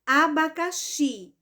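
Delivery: slow "Abacaxi" with a stressed final "xi"; deliberate demonstration of "Abacaxi", strongest on "xi"; stress on last syllable. Shown as a demonstration. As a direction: spread out "Abacaxi", weight on "xi". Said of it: "Fecha os olhos e pronuncia".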